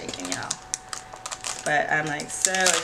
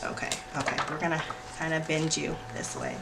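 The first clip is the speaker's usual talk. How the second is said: whisper; 3 semitones lower, 8 dB quieter, much faster than usual. Real, not acted. Quiet self-talk bending book.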